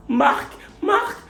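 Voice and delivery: en riant. Real, not acted. Rires, appel aigu.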